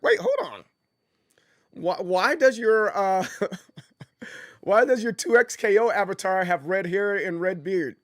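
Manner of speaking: amused surprise. Real, not acted. Streamer noticing a viewer's red-haired avatar, amused surprise. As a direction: catch yourself in amused disbelief. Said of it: "I was like".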